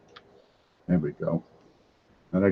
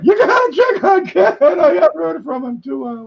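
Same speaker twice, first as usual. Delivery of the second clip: much higher, louder worried voice; 4 dB louder than usual. Retold or imitated. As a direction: squeak out the fretting quote. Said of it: "it's more like".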